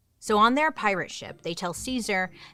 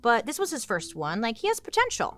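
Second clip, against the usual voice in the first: high, excited praise; pitched-up admiring gush; impressed; 7 semitones higher, 3 dB louder than usual. Acted.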